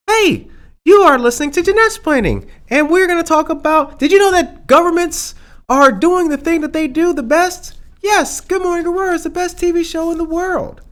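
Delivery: high, loud put-on Jeanette voice; Jeanette impression. Host mocking his cohost in a high loud voice. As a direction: squeal it up high like a radio host. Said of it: "Let's talk like Jeanette".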